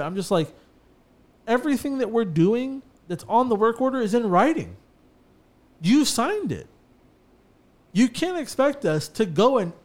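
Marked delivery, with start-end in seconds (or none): 1.5-9.7 higher-pitched, emphatic pushback; firm retold rebuttal. Contractor to customer disputing the work order, firmly emphatic. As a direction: lay down the terms to a disputing customer.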